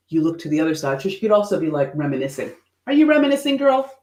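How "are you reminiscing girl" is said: high, loud playful question; teasing. Real, not acted. Asking the photo woman playfully. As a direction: tease the face in the photo. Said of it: "playful teasing question".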